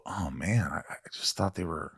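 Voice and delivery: low, hushed aside; quiet retold letdown. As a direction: murmur it low, as a private disappointment. Voicing private letdown about co-stars.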